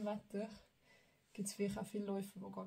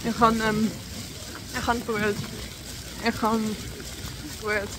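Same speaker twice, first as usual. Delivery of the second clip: loud; 13 dB louder than usual. Real, not acted. Shouting after the race.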